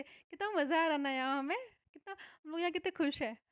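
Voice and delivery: put-on fast, chattering like happy children; excited kids. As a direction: rush it out like a delighted child. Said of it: "ऐसा नहीं बोलते बच्चे". (Teacher बच्चों की खुशी की नकल करती हुई, तेज़ और उत्साहित)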